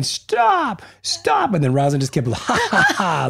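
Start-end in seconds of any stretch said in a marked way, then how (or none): 0.0-1.5 put-on yelling child. Father imitating his upset son, mock child yell. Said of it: "He was yelling". 2.3-3.3 imitated taunting laugh; mocking laughter. Father imitating his wife laughing at their son, mocking. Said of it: "laughing in his face".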